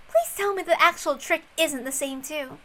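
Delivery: plain reading voice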